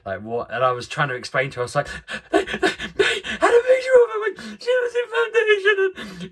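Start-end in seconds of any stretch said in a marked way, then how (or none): 2.3-6.3 crying too hard to be understood; sobbing. Retold or imitated. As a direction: blubber the words out, barely understandable. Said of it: "calmed down 'cause I was so embarrassed".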